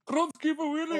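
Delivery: very high, cartoon Scottish janitor voice; Groundskeeper Willie impression. Naming the Simpsons janitor in his voice. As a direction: squeal the name in a Willie voice. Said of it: "the guy from Simpsons, the janitor".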